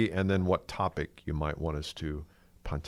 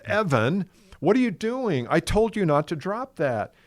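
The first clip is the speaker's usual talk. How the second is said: high, raised scolding voice; mock scolding; 10 semitones higher than usual. Grandpa scolds his grandson for dropping the car, high and stern. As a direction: scold the kid, pitched way up.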